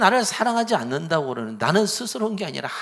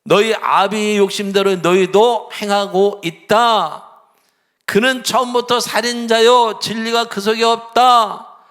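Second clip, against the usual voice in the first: loud, higher, slow condemnation; stern loud rebuke; 4 semitones higher, 9 dB louder than usual. Retold or imitated. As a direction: pronounce judgment on the liars. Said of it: "그들을 향해서 얘기하는 거죠". (크게 천천히 꾸짖으며)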